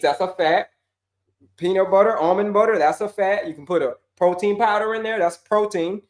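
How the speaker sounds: ordinary talk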